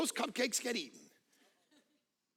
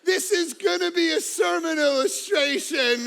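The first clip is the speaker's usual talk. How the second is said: mocking shout; 10 semitones higher, 9 dB louder than usual. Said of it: "That's what she said".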